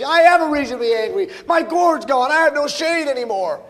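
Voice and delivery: high, loud mock whine; hammed-up petty indignation; complaining and whining. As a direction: whine loudly like a sulky complainer. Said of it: "he starts complaining and whining".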